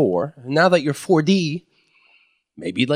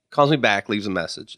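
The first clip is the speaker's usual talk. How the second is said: usual speaking voice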